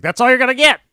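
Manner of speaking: high, loud mock yell; imitating a loud guy. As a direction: bellow it like an abrasive joker at the cashier. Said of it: "very loud".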